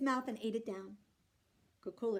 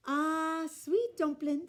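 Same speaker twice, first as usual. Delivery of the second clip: slow loud fond coo; slow doting coo; cooing mother; 5 semitones higher, 6 dB louder, much slower than usual. A narrator's reading. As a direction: coo over the baby. Unag coos to the baby.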